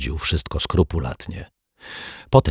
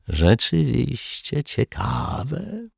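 high, soft, drawn-out agreement; mock agreement; 9 semitones higher, 5 dB quieter, much slower than usual. A narrator's reading. Rogue nods along with the detective, mock-agreeing. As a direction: stretch it out high and softly. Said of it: "przytaknął Martwiak".

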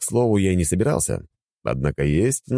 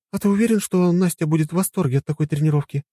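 higher skeptical woman's voice; skeptical wife; 14 semitones higher, faster than usual. Acted. Wife voiced, female role. (с сомнением)

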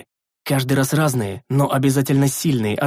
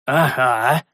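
chuckling. A narrator's reading. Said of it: "со смешком отозвался я".

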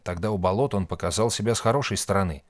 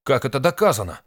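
loud, raised incredulous question; unrestrained outburst; 6 semitones higher, 6 dB louder than usual. A narrator's reading. Nik protesting that guilt is proven, indignant outburst. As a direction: blurt it out in disbelief. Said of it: "не удержался Ник".

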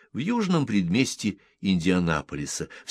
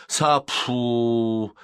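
raised-pitch nonsense threat; mildly threatening cry; 5 semitones higher, 4 dB louder than usual. A narrator's reading. Sleeper shows how he will deal with it, threatening cry. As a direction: show them how you'll deal with it. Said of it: "ясно показывая, как он с этим разделается".

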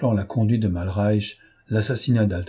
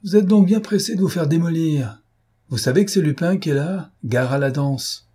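high-pitched calling out, slowing at the end; shouted taunt; 7 semitones higher, 3 dB louder than usual. A narrator's reading. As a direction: holler a warning to the attackers. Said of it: "cria-t-il".

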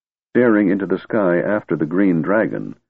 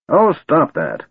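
put-on higher, sharp old wizard snap; snapped; 9 semitones higher, 3 dB louder, slower than usual. A narrator's reading. Old wizard tells bowing men to stop, sharp snap. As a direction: brush off their bowing. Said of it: "Fizban snapped".

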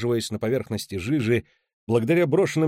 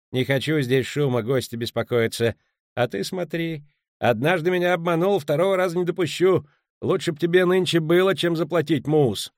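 stern warning; 6 semitones higher, 3 dB louder than usual. Acted. Landlord warns Muus.

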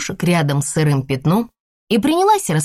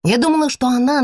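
plain reading voice

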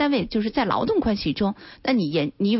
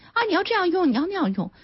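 higher-pitched mock busybody giving instructions; meddling instructor; 8 semitones higher, faster than usual. Lawyer mimicking busybodies who instruct you on phone use, mock instructing. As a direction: imitate people eagerly telling someone what to do. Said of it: "好多人在指导你".

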